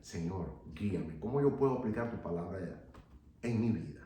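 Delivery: hushed humble prayer; quiet prayer. Shown as a demonstration. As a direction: pray it gently and humbly. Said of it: "pienses con Dios y digas".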